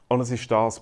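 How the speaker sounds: usual speaking voice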